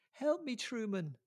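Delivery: high pleading woman's cry; crying for help. A narrator's reading. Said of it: "she cries".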